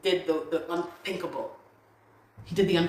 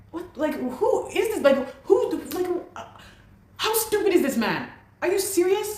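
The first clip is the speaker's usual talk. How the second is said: much higher, shocked What the heck; shocked outrage; 12 semitones higher than usual. Real, not acted. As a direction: squeal the disbelief at the news. Outrage at unpaid rent.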